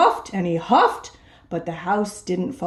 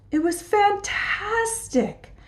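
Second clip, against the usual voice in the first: much higher, slow, swooning; dreamy delight; 12 semitones higher, 3 dB quieter, slower than usual. A narrator's reading. Narrator swooning as the pig smells the flowers, slow. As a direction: linger on it as if lost in the scent.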